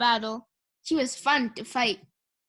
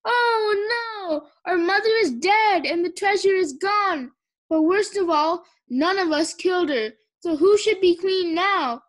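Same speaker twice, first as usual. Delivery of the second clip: high, loud mock wailing; cry of anguish; 6 semitones higher, 5 dB louder than usual. Tsunami plays the bereaved princess, mock anguish. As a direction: wail it like a melodramatic mourner. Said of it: "let out a cry of anguish".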